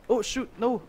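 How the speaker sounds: high-pitched alarm; high, panicky dismay; dismayed cry. Real, not acted. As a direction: cry out high as it slips away. Loses the rope in game.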